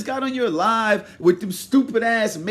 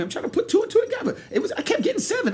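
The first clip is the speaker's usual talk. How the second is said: fast confused rambling; 12 semitones higher, 4 dB quieter, much faster than usual. Real, not acted. Fast mock confusion, laughing.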